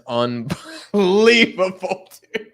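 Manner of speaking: real laughter taking over, slow and loud; words dissolving into helpless laughter; breaking into laughter. Real, not acted. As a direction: crack up halfway through the word. Cracking up at the chat.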